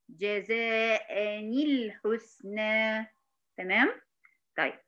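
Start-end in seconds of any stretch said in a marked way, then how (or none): 0.1-3.1 جزاء الحسنى very slow, "jazā'ani-l-ḥusnā"; corrected linked recitation. Shown as a demonstration. Teacher shows what it became, stretched recitation. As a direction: draw out every long vowel in جزاء الحسنى, linked with ni. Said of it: "بقت".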